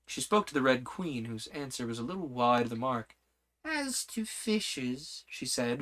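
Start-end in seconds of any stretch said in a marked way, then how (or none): 3.6-5.2 very slow, solemn, softer. Acted. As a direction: draw it out gravely into her ear. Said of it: "very slowly and solemnly".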